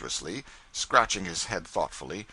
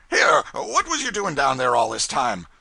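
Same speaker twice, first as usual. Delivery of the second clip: loud, higher suspicious demand; gruff demand; 5 semitones higher, 8 dB louder than usual. A narrator's reading. Brown questioning the returning cub, loud and suspicious. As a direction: grill him for the delay.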